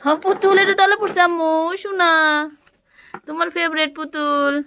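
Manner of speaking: put-on high, bright play voice for a small child; playful toddler-talk. Mother playing house with her toddler, high coaxing voice. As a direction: coax the little one along in pretend play.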